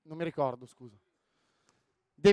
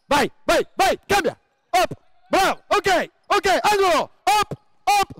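high loud rapid calls to players; calling out; 10 semitones higher, 5 dB louder than usual. Real, not acted. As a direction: drive them on during play. Coach calls the live drill. (incitamento gridato ad alta voce)

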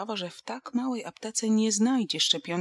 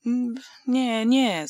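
protesting; 5 semitones higher, 6 dB louder, much slower than usual. A narrator's reading. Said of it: "zaprotestowała Łucja".

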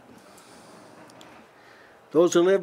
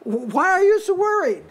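much higher, airy angel asking Hagar; angel's voice; 12 semitones higher, 3 dB louder than usual. Acted. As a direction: lift way up as the angel calling Hagar. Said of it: "this is an angel talking".

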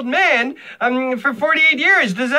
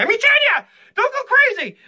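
mock panic; 12 semitones higher than usual. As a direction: yelp the fix before she snaps. Panicked correction to wife.

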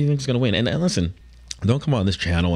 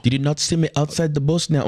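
higher-pitched mimicked Irish accent; 7 semitones higher than usual. Mimicking Conor's trash talk from the clip, in an Irish accent. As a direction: echo the fighter in mock Irish. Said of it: "when I'm doing the Irish accent".

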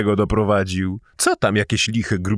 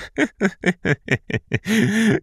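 mocking laugh; 7 semitones higher than usual. Character laughs at reply.

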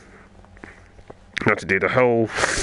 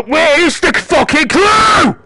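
high, loud, drawn-out yell of real frustration; frustrated shout; 8 dB louder, slower than usual. Real, not acted. Gamer raging at a clue he can't find, angry shout. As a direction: shout it in exasperated rage, slowly.

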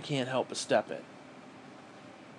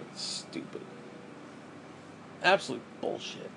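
whisper; 2 semitones lower, 8 dB quieter than usual. Real, not acted. Muttered disgust aside.

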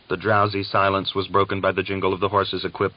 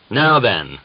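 higher, louder threatening cry; threatening exclamation; 4 semitones higher, 6 dB louder than usual. A narrator's reading. Sergeant runs to stop Gregor firing, threatening. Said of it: "the sergeant exclaimed threateningly".

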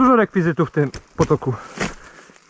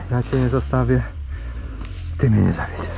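tender; 10 semitones lower, 6 dB quieter than usual. Pretend. Talking tenderly to his motorbike.

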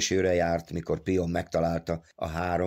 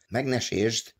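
higher, anxious caution; warning; 5 semitones higher, slower than usual. A narrator's reading. Pion telling Big Joe not to damage it, warning. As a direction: urge care over the find. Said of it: "óvta Pion".

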